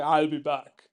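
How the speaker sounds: loud, badly faked action-robot voice. Giving a movie quote example, bad Terminator impression. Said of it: "It's a really bad impression".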